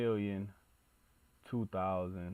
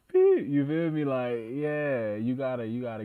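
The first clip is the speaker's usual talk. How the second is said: loud, pitched up and hyped; excited outburst; 5 semitones higher, 11 dB louder than usual. Real, not acted. The streamer hypes up his chat, loud and excited. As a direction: burst out with a loud hype call.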